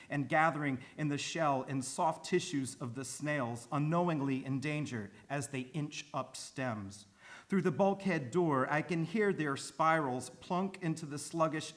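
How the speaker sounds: measured, emphatic poem recitation; formal reading, each phrase firmly weighted; poetry reading. Acted. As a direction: read the poem aloud with steady, deliberate force. Reads a poem aloud.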